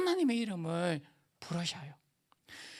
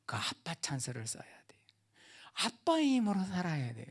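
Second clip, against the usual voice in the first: hushed emphatic whisper; preacher whispering the key point to the congregation; 7 dB quieter than usual. Real, not acted. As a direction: drop to a whisper to stress it.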